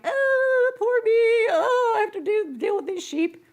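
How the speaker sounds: high, loud whiny wail; hammed-up "poor me" whining; mock self-pity. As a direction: wail it like a put-upon complainer. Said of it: "he didn't sit there going".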